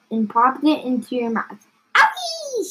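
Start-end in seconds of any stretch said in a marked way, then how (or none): 2.0-2.7 very high storybook squeal; mock scream. A narrator's reading. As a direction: squeal it way up high, without extra volume. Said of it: "she screamed".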